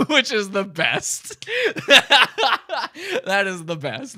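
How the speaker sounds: words through laughter; laughing. Real, not acted. Vlogger laughing at Chris's cigarette request. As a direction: laugh while praising it.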